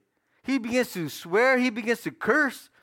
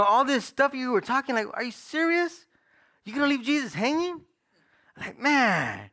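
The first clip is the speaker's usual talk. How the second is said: retold indignation, pitched up; angry disbelief; 5 semitones higher than usual. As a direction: challenge him as if you can't believe it. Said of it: "I went to work angry".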